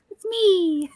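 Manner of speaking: put-on squeaky high baby-bird voice; cute parrot baby voice. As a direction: chirp it like a tiny talking parrot. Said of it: "a little teeny tiny little baby voice".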